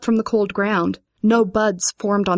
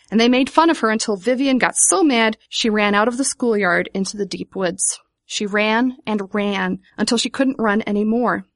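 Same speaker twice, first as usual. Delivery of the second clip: plain reading voice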